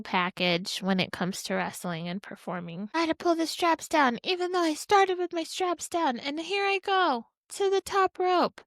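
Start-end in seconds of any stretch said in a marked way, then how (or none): 2.9-8.6 higher, hammed-up wrestler talking to himself; wrestler's inner voice. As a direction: play the wrestler narrating his own move. Voicing wrestler's thoughts.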